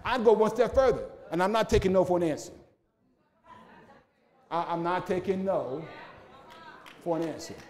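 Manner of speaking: loud, rising preacher's cry; climbing into a loud sermon peak; full-force preaching. Acted. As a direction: preach it out to the whole church. Pastor builds into full preaching.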